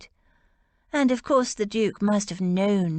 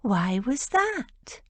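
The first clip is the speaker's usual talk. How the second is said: slow, higher, syrupy teasing question; mock-sweet coo; 5 semitones higher, slower than usual. A narrator's reading. Rose needling George with false sweetness, slow mocking coo. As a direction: drag it out sweetly to needle her. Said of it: "Rose cooed".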